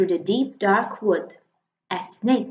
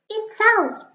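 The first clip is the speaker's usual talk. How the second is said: much higher mouse, bright and quick; little mouse voice; 12 semitones higher than usual. Acted. The mouse announcing Snake, high little voice. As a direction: pitch it up like a tiny mouse.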